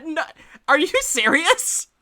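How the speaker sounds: high, slow, stunned disbelief; high incredulous are you serious. Acted. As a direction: she can't believe what she's hearing.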